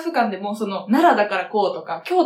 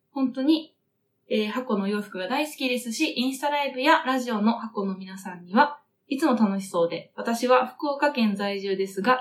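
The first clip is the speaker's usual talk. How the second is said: softer, measured letter reading; reading listener mail aloud; 5 dB quieter than usual. Real, not acted. Host reading a listener's letter to colleagues, measured read-aloud voice. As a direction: read the fan letter out carefully and evenly, keeping it soft.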